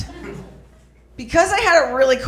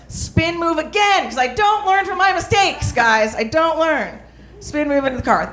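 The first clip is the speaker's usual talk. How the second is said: higher, bouncy admission of not learning; self-mocking excitement; 6 semitones higher than usual. Comic to the crowd, admitting she never learns, gleefully higher. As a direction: sell it as a proud bad habit.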